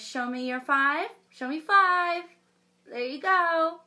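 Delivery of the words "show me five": ordinary delivery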